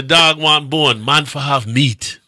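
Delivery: put-on Jamaican accent, said slowly; slow Jamaican proverb delivery; slow Jamaican accent quoting a proverb; slow Jamaican saying. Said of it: "them say".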